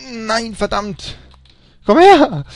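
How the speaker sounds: loud, high frustrated yell; frustrated shout. Real, not acted. Frustrated gamer yelling at game.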